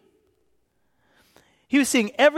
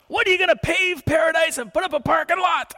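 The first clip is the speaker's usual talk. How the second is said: indignant lady; 9 semitones higher than usual. Retold or imitated. Neighbor lady accusing the tree crew, high outraged shout. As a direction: hurl it like an outraged protester.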